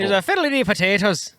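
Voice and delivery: a bit high mock Irish lilt; clumsy stab at an Irish brogue; fake Irish accent. Joking about potatoes in a bad fake Irish accent. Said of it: "I can't do an Irish accent".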